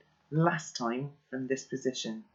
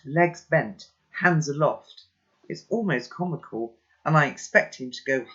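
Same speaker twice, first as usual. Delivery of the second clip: ordinary delivery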